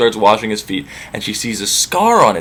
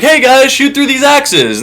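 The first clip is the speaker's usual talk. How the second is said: high, slow, chirpy Penelope voice; 11 semitones higher, slower than usual. Acted. Penelope challenging suitors.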